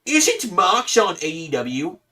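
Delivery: high, smug, nasal know-it-all; Fedora guy voice. Retold or imitated. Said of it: "why did you do it like the Fedora guy voice".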